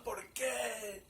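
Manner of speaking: yelling. Retold or imitated. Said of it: "estaba gritándolo".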